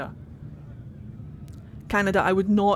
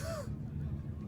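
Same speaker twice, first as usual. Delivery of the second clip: breathy giggle; 18 dB quieter than usual. Real, not acted. Laughing at her own accent.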